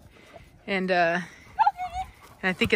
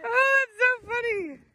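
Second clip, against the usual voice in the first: high, giggly delight; laughing; 12 semitones higher than usual. Real, not acted. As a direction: squeal it through a laugh. Laughing at the dogs.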